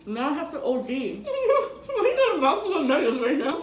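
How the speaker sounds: laughing loudly; laughing while talking. Real, not acted. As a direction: speak through loud laughter. Laughing at mouthful.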